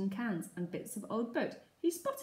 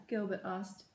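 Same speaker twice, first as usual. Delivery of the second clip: ordinary delivery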